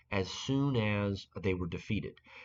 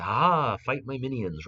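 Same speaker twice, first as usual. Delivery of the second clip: loud, higher "Ha" easing into a taunt; mock battle yell; 3 semitones higher, 5 dB louder than usual. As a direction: yell out like an enemy summoner, half in earnest. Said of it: "they suddenly yell out".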